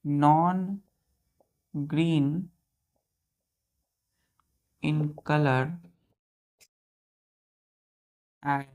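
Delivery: very slow dictation with long pauses. Shown as a demonstration. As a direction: dictate each word for the class to write down. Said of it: "star दे के लिखिएगा".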